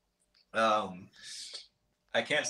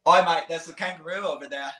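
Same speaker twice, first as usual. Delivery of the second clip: a lightly put-on, hammy Australian accent, pitched higher; bad Aussie accent; 5 semitones higher than usual. Asked by the host for his best Aussie accent, a bad Australian accent. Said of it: "give me your best Aussie accent".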